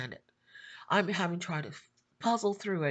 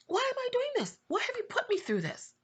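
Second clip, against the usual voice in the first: much higher, voiced, pained plea; asking the Lord, high and hurt; high anguished cry. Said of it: "I'm frustrated. I'm angry. I'm hurt".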